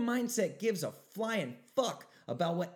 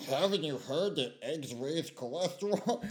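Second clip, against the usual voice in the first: mocking whiny critic. Mocking the critics who warn him about eggs, lightly sneering. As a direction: parrot the critics with a mild sneer. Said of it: "And they're like this".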